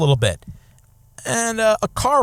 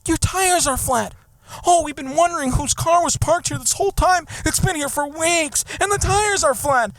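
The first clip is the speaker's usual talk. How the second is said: much higher fussy neighbor, slowed; high mock neighbor voice; 10 semitones higher than usual. Speaker mimics the neighbor reporting flat tires in a high voice. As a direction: mimic the busybody in a high sweet voice.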